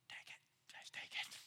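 a soft whisper giving up; whispered surrender. Acted. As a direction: barely breathe it out, too scared to fight.

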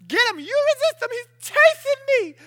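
loud, very high frantic cry; mock panic while fleeing; panicked plea. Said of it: "You're like".

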